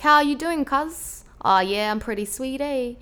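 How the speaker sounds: laid-back New Zealand bro exchange; cheeky, lazy street Kiwi with lifted endings; lazy Kiwi street accent. Shown as a demonstration. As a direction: play two young Kiwis greeting each other. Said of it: "it's, like, a real lazy accent".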